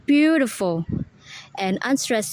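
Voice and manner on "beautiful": "beautiful" joined up, first syllable strongest; stress on BEAU. Shown as a demonstration. Student repeating primary stress, BEAU punched in "beautiful". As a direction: lean on BEAU in "beautiful", then drop. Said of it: "primary stress is beau".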